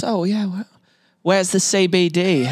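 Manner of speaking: put-on a light, slow Australian accent. Canadian guest mimics an Australian asking for the CBD, light Aussie accent. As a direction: drawl it like an Aussie asking directions. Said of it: "That's my best Australian accent right there".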